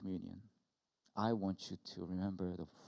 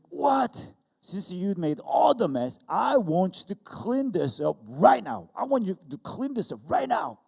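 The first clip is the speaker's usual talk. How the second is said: loud, high, slow scolding shout; re-enacted furious dad, each order punched; even angrier; 11 semitones higher, 14 dB louder than usual. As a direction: lay down the law to your kid. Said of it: "I got even angrier".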